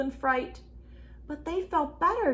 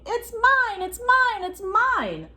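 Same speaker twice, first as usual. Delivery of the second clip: much higher, louder chant of mine; mock shouting; 12 semitones higher, 6 dB louder, slower than usual. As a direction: squeal mine like quarrelling kids. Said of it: "I can hear you shouting".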